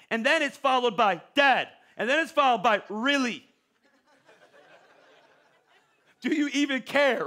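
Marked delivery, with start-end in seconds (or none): 1.3-1.7 one loud insistent word; demanding kid. Retold or imitated. Kids' impatient texts escalate. 2.9-7.2 higher, louder mock indignation; offended kid. Kids' impatient texts escalate.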